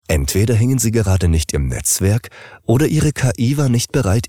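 ordinary delivery

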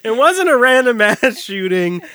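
laughing through "random ass". Real, not acted. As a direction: giggle through the words. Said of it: "I got caught up on the words".

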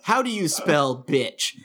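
ordinary talk, nothing marked

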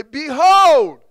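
loud, high cry with a long fall; rallying shout. Shown as a demonstration. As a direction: shout it for the congregation to echo. Calls congregation to say it along.

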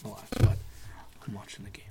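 hushed whisper, barely voiced; sneaky whispered aside to a chatty girlfriend. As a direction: whisper it so she won't hear you. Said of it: "Pipe it down".

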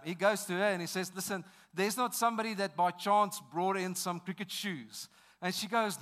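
preaching at full force. Acted. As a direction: build the story from the pulpit. Preacher tells story at full force.

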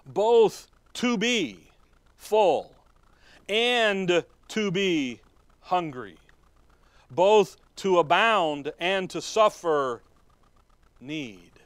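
slow, loud, drawn-out preaching; slow emphatic preaching. Real, not acted. Preacher expounding verse to congregation, slow and loud. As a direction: stretch each word for weight.

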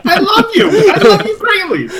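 high-pitched, hammed-up lovey voice; mock declaration of love. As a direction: gush love in a silly high voice. Player mock-pleads love to Bjorn.

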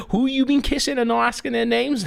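high, teasing scolding voice; a hammed-up nosy mother; high mock scold; mock mum voice. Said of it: "she was like".

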